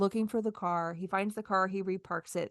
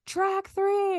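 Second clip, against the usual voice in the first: mock conductor call; 11 semitones higher than usual. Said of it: "shout at you".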